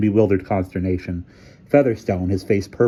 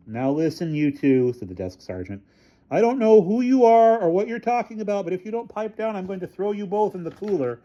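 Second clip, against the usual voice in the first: character voice; 12 semitones higher than usual. A narrator's reading. Desk sergeant warns.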